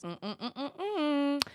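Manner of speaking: high knowing mm-mm hum; sarcastic hum. Real, not acted. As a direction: hum a doubtful no. Sarcastic hum after question.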